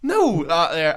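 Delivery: retold argument, voice up; acted heated retort. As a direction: push back loudly. Acted reply in the quarrel.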